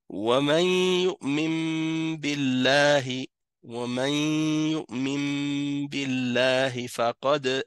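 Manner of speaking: chanted "يؤمن بالله", the N becoming a long hummed M; noun recited as mim. Shown as a demonstration. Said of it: "le noun comme un mim".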